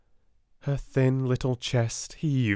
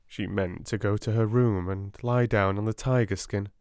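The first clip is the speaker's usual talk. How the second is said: ordinary delivery, nothing marked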